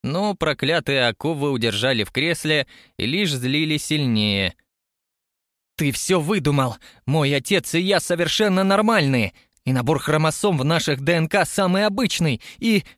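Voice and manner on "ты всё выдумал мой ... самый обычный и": hysterical. A narrator's reading. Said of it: "Забилась в истерике".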